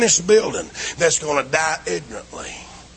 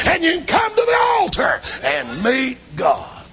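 full-force preaching; 11 semitones higher than usual. Acted. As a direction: preach it at the top of your voice to the congregation. Altar call climax.

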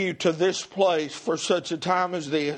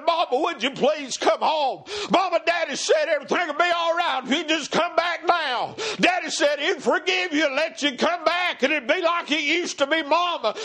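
preacher voicing children's plea, pitched up; begging children; 7 semitones higher than usual. Children beg their mama to come home, urgent pleading. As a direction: implore her in a high, driving preacher's cry. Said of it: "you see those children beg their mama".

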